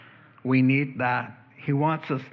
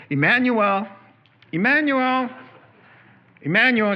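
much higher, louder called-out name; calling out a name; 9 semitones higher, 5 dB louder than usual. Retold or imitated. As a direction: call the name across a waiting room. Nurse calling name in waiting room.

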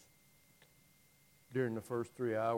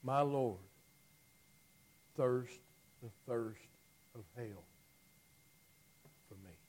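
much softer, lower, fading to a breath; fading hush; 3 semitones lower, 5 dB quieter than usual. Real, not acted. Preacher ending on the thirst of hell, fading hush. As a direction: let it die away reverently. Fading reverent sermon end.

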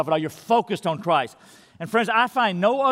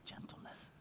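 breathy whisper, barely audible; whispered gentleness; 29 dB quieter than usual. Shown as a demonstration. Pastor modelling gentleness for the congregation, in a whisper. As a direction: whisper it tenderly. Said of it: "a gentle tone of voice".

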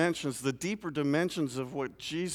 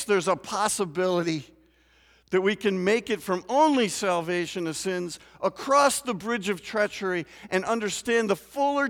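higher, louder preaching at full force; 9 semitones higher, 8 dB louder than usual. Acted. Pastor warning his congregation about chaos, fervent loud preaching. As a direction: build the sermon to a fervent climax.

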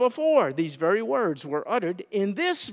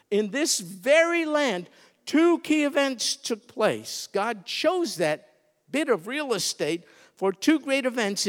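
normal talking voice

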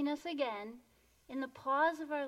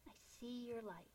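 meditative; 16 dB quieter than usual. Real, not acted. Speaker blessing the silent audience, softly meditative. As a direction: gently affirm each listener.

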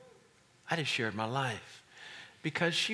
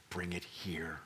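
soft whisper; 7 semitones lower, 6 dB quieter, slower than usual. Real, not acted. Whispered plea.

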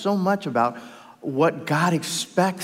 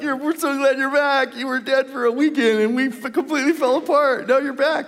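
higher, eager disciples gushing with relief; relieved disciples; 8 semitones higher than usual. Pretend. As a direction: play the disciples, overjoyed he's back. Disciples voiced welcoming Jesus back.

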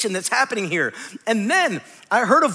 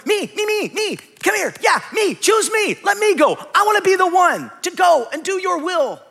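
much higher, louder, excited pleading of me me me; pick-me eagerness; 9 semitones higher, 3 dB louder than usual. Acted. Isaiah pleading with God to choose him, excited and high. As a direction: beg to be chosen like a kid bouncing in his seat. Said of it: "Isaiah's going".

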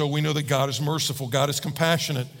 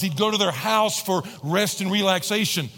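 ordinary talk

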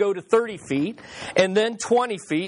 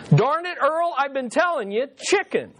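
exasperated wife; 6 semitones higher than usual. Retold or imitated. Exasperated wife answers again.

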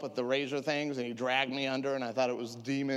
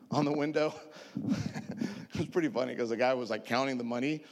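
normal talking voice

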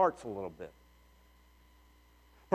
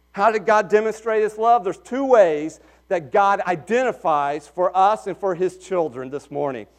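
normal talking voice

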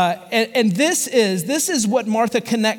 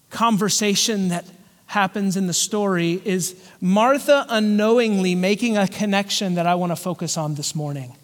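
ordinary talk

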